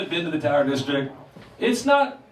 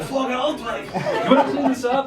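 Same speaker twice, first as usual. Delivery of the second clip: higher, pleading mock threat; mock negotiation; 6 semitones higher than usual. Comic negotiating with a burglar, higher pleading tone. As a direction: bargain with a burglar, half joking.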